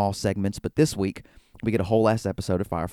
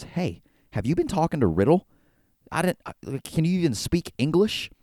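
higher-pitched, incredulous quizzing; retold exasperation, pitched up; exasperated questioning; 8 semitones higher than usual.

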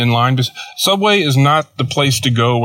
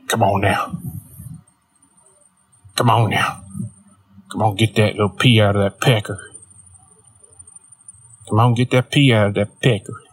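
Carl from Sling Blade. Retold or imitated. Coworker talking to himself at the urinal, muttered character impression. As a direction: imitate a man coaxing himself along, muttered and halting. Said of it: "he's Carl from Sling Blade".

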